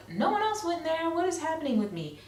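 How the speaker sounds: high, fast anxious inner voice; panicked thought. Retold or imitated. Alone in the waiting room, asking herself in high rushed alarm.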